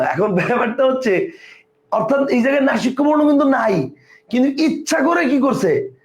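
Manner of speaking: normal talking voice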